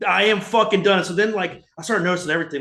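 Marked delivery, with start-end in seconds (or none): 0.1-1.0 louder, emphatic I'm-done declaration; fed up. Retold or imitated. Fan retelling his breaking point, louder and emphatic. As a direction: slam the words down like a fan who has had enough.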